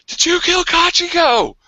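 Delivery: high, loud, slow accusation; accusing cry. Acted. Gambler confronting the lieutenant, high loud accusing cry. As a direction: demand it with a pointed finger. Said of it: "he points at me and he's like".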